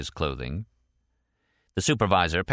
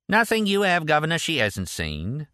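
mock Cockney; 9 semitones higher than usual. A narrator's reading. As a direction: snap it out in a put-on Cockney accent. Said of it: "snapped Peaches and Cream in a mock Cockney accent".

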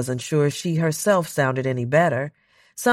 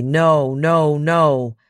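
louder, frantic repeated no; 2 semitones higher, 6 dB louder, much slower than usual. Acted. Marshall refusing Donna's choice at the console, loud and frantic. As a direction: keep denying it as it slips away.

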